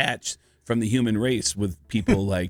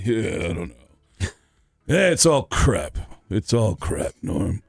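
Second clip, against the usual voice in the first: weary, miserable old comic impression, drawn out slowly; Rodney Dangerfield; 4 semitones lower than usual. Miserable comic griping to Norm in his green room, dejected impression. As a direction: grumble it out in dejected bursts with long pauses.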